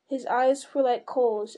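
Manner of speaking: usual speaking voice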